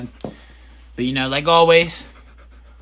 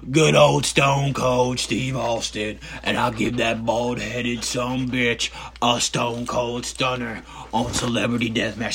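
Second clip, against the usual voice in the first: Stone Cold promo; slower than usual. Acted. Wrestler promo voice continues.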